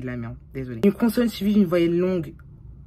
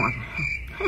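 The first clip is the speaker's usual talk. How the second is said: ordinary talk, nothing marked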